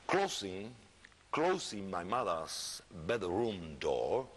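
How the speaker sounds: slow, measured reading with long pauses; deliberate, unhurried literary reading; story narration. Acted. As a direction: read the passage aloud slowly, letting each phrase hang. Reads book passage aloud.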